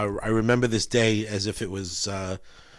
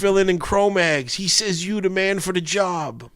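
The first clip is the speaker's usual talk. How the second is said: high, louder hardcore-singer voice; 9 semitones higher, 6 dB louder than usual. Retold or imitated. Recruiting [name] for the band, in a high, louder tough-guy voice. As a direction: pump it up like a hyped New York frontman.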